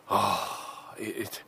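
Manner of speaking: usual speaking voice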